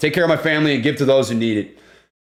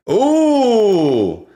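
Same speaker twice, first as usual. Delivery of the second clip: excited ooh; 7 semitones higher, 4 dB louder than usual. Real, not acted. Sees the car photo.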